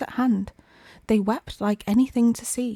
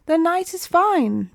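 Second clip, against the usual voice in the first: sly walrus; 8 semitones higher, 4 dB louder than usual. A narrator's reading. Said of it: "the walrus said".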